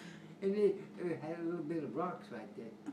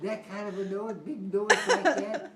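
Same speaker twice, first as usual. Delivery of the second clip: amazed, laughing; 4 semitones higher, 10 dB louder than usual. Retold or imitated. Brother marvelling at the cow's noise, louder and laughing. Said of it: "brother asks about the noise".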